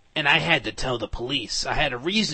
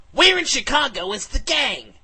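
much higher, louder demand; repeated demand; 11 semitones higher, 9 dB louder than usual. Acted. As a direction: yell the question at them a second time. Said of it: "and I repeated".

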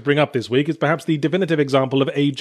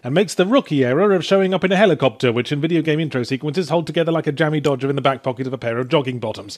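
normal talking voice

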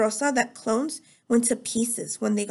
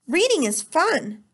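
slow, higher and louder hearty cheer; hearty teacher voice; 6 semitones higher, 3 dB louder, slower than usual. A narrator's reading. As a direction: pump false enthusiasm into every word. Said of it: "teacher said in a hearty voice".

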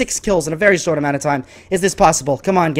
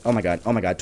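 ordinary talk

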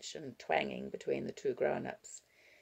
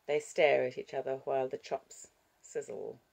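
usual speaking voice

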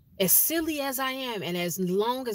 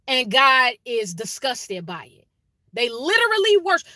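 much higher and louder, fired-up preaching; preaching shout; 4 semitones higher, 7 dB louder than usual. Real, not acted. Host warning viewers about idolizing children, fired-up preaching shout. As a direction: let it ring out like a pulpit call.

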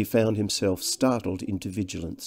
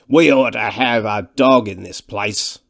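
slow, loud grumble; ponderous big man's voice; loud-voiced thick man; 7 dB louder than usual. A narrator's reading. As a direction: complain heavily, ignoring the visitor. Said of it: "his massive and loud-voiced companion".

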